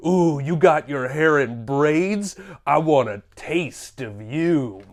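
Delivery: low, soft, slow leer; mock seductive. Host flirting with the braided Viking on the cover, mock seductive. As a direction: savour the words like a creepy admirer.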